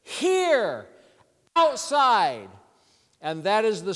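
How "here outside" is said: three words called out slowly, one at a time; mock shout. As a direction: imitate a commanding shout, raised and drawn out. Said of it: "He shouted".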